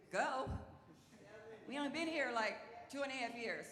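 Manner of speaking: high-pitched, acted-out disbelief; mock-stunned protest; incredulous reaction. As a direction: balk at the order in a high voice. Acts out disbelief at command.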